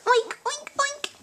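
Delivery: high-pitched oinking piggy. Shown as a demonstration. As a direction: oink it up high like a little pig. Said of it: "what piggies say?".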